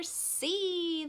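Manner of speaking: high, drawn-out mock yell; imitated children's shout, stretched; kids yelling answer. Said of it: "they would yell".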